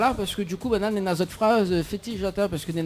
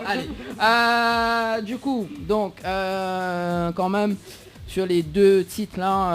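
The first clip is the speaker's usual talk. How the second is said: ordinary talk, nothing marked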